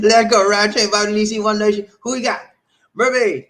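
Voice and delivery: loud, high roll-call holler; hyped shout-out. Real, not acted. Host calling out viewers by name, hyped shout-out. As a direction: belt out the names like a hype man.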